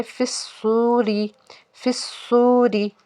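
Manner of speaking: फिस्सूरी with a whistling s and long सू; slow फिस्सूरी, heavy full s, drawn-out oo; stretched waw. Shown as a demonstration. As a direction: hold the oo of फिस्सूरी for one alif. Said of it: "खींच के भी अदा करना है".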